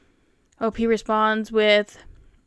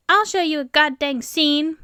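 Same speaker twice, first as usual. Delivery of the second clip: mildly huffy threat, pitched higher; huffy customer; 5 semitones higher than usual. A narrator's reading. Angry customer stomping out of the store, huffy. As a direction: huff it out as a parting threat. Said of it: "she stomps off huffing and puffing saying".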